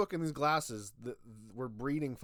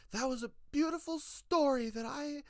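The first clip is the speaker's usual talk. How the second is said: mock gushing viewer; 12 semitones higher than usual. Mocking viewers moved by the ad, in a high gushing voice. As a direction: parody a teary commenter. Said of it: "They're like".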